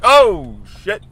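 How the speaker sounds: high, very loud mock scream; acted burst of alarm; scared. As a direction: yell out like a frightened kid. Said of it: "And I got scared".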